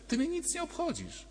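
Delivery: a hint of a cold Pilate brushing Jesus off; Pilate speaking. Pilate tells Jesus he doesn't care, in a lightly voiced high soft tone. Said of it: "Tu Piłat był szczery".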